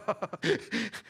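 high-pitched chuckle; laugh. Real, not acted. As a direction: laugh it out mid-story. Laughs while telling friends' reaction.

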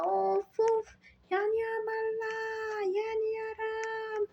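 high, strained yells of burning. Acted. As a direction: cry out like you are on fire.

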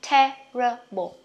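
Terrible drawn out slowly, syllable by syllable; slow teaching take of Terrible; slow model. Shown as a demonstration. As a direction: stretch Terrible out before the quick take. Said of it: "Đọc nhanh nè".

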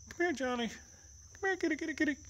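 very high, soft coaxing; high pet call. Real, not acted. Owner calling Johnny and kitty over, high-pitched coaxing. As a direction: beckon the cat in a tiny high voice.

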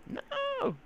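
very high squeaky whine rising to a cry; hammed-up falsetto no; mock wail. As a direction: wail like a cheated child. Wailing over losing ender pearls.

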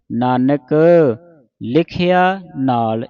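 ਨਾਨਕ with two plain n sounds, then pause; slow ਲਿਖਿਆ ਨਾਲ after a rest on ਨਾਨਕ; dental n. Shown as a demonstration. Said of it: "ਇਸ ਤਰ੍ਹਾਂ ਪੜ੍ਹਨਾ ਹੈ". (ਨਾਨਕ ਨੰਨੇ ਨਾਲ, ਹੌਲੀ ਪਾਠ)

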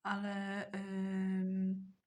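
ordinary talk, nothing marked

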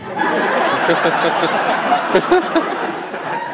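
real burst of laughter; loud laughter. Real, not acted. Laughing at Tommy's interview.

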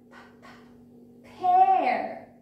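little p puffs, then a high, loud "pear"; isolated p sound. Shown as a demonstration. Teacher showing children the first sound, isolated p. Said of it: "all of these has p sound".

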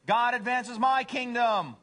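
high, loud, slow boast; self-centered cat. Acted. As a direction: trumpet it like a conceited cat. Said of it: "First one is".

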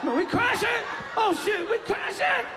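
high panicked yell we're crashing; panicked shout. Acted. Passenger panicking as the plane crashes, high panicked shout. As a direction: scream it, terrified.

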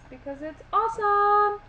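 high loud gush; excited. Real, not acted. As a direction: burst out with joy. Enthusiastic reply about steampunk.

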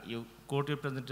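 normal talking voice

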